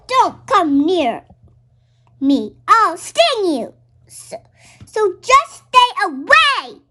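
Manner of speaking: loud, high threatening wasp voice; loud buzzing threat; menacing warning. Acted. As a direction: warn them off loudly, like a stinging wasp. Wasp's colors warn predators.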